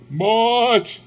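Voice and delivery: high, eerie single word from the ghost; ghostly Marley voice. Acted. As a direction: let the ghost answer from far away. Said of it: "Marley's voice".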